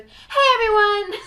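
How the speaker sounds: high, loud excited hello; bright-pitched, punchy host greeting shouted out; signature podcast greeting. Shown as a demonstration. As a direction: demo a big peppy show opener. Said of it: "every time the beginning starts with".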